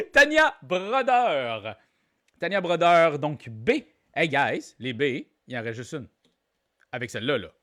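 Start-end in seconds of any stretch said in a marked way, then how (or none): 0.0-1.8 loud, high laughing name call. Real, not acted. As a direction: break up laughing, then draw out the name. Laughs after own singing, names winner.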